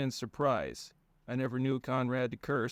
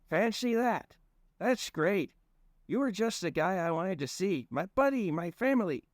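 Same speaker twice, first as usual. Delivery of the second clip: high chummy stranger; 8 semitones higher than usual. Acted. Stranger greets cousin.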